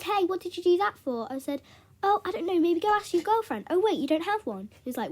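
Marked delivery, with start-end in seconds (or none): 0.0-1.3 higher-pitched mock indignation; indignant protest. Retold protest over ruined test paper. 2.0-4.7 high, loud mock innocence; sarcastic taunt. Retold taunt in revenge scene.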